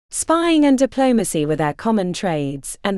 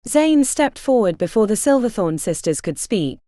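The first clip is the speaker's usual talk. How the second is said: usual speaking voice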